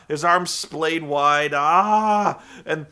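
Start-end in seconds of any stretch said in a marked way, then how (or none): 1.5-2.4 louder mock roar again; hammed-up roar; Abyss yell. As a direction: roar like a wild wrestler. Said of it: "he's like".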